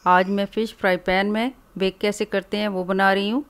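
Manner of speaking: normal talking voice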